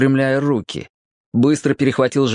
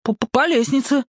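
higher, halting; stammering boy; 12 semitones higher than usual. A narrator's reading. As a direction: Petka answering in shock. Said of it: "машинально ответил Петька". (мальчишеский голос, запинаясь)

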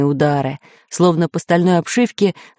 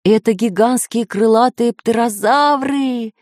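high, excited exclamation; raised-pitch excited professor; professor crying out; 6 semitones higher than usual. A narrator's reading. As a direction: cry out the discovery. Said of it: "закричал профессор Пыхтелкин".

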